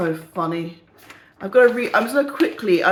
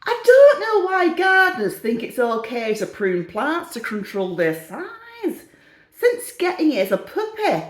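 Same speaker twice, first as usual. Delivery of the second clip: hammed-up indignant reader's letter; put-on letter-writer voice; 4 semitones higher than usual. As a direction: read it out as a comically aggrieved letter writer. Reads another letter in put-on voice.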